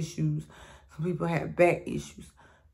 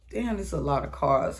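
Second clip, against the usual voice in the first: normal talking voice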